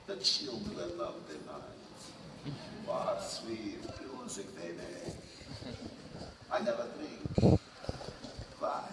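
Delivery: low, slow Dracula voice; slow, soft vampire count; Bela Lugosi Dracula. Retold or imitated. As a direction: drag out the count's menace. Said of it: "who talks like this".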